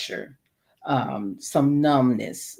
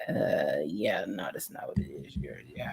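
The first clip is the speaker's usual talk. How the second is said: low, soft near-whisper of a doctor waving it off; hushed doctor; 8 semitones lower, 11 dB quieter than usual. Retold or imitated. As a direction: quietly brush off the patient. Doctor dismissing patient in ER.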